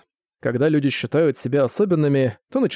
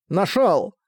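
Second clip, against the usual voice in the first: higher-pitched triumphant cry; exclaiming; 5 semitones higher than usual. A narrator's reading. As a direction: exclaim on spotting the hidden brother. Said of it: "воскликнул Саски".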